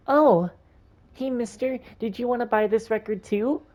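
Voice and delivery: put-on little girl. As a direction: play an eager little girl. Voices little girl character.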